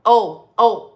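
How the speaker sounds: ordinary talk, nothing marked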